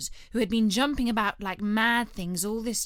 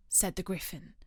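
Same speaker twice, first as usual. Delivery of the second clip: ordinary delivery, nothing marked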